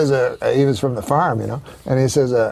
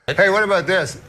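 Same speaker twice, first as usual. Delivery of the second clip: ordinary talk, nothing marked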